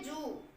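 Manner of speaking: जू with a long ऊ; long vowel. Shown as a demonstration. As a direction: hold the ऊ in जू long. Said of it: "long ऊ हम pronounce कर रहे हैं".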